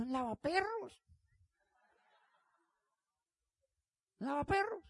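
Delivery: high, soft mock woman's voice saying Un lavaperros; imitating a woman's scornful aside. Imitating a woman who scorns him, high and soft.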